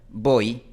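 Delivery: "voy" con final cerrado; palabra española. Shown as a demonstration. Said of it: "como escuchas termina en una posición bastante cerrada". ("voy" cerrado)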